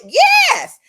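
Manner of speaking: a very high, loud, laughing "Yes!"; excited shout. Acted. Answering the crowd about his bone structure, laughing shout. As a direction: scream the yes like a fan.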